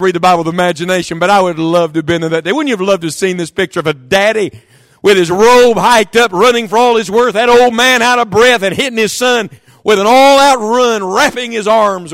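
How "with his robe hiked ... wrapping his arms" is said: animated preaching. Acted. Preaching at full force.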